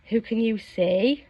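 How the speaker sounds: ordinary delivery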